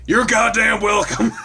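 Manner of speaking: high, loud gleeful shout; mock shout. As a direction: yell it back with glee. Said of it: "Steve's punchline".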